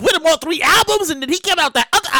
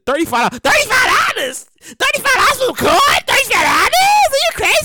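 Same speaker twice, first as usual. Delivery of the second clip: price shock; 10 semitones higher than usual. Pretend. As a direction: yell like you got robbed.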